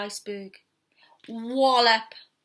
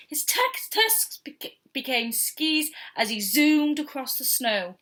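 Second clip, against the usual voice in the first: ordinary delivery, nothing marked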